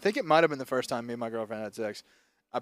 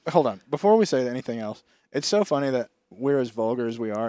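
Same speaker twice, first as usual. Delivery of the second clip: usual speaking voice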